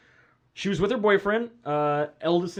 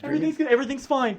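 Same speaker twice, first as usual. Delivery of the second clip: mock mother insisting all is well, pitched up; placating mom; 9 semitones higher, faster than usual. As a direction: play a mom covering up the trouble. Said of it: "And, you know, mom's like".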